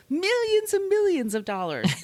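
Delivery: over-the-top mock wonder; mock grandiose. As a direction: ham up the legend of his riches. Mocking the famous Hughes claim.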